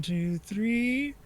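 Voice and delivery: ordinary talk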